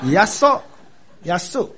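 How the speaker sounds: 亚苏 pronounced like Taiwanese, high and lifted; Taiwanese-style pronunciation. Shown as a demonstration. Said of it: "跟我们台湾话念起来很像".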